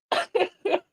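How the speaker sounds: high-pitched real chuckle; high laugh. Real, not acted. Starting to tell how she was hot, high helpless laugh. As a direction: break into high giggles mid-sentence. Cracking up while retelling the story.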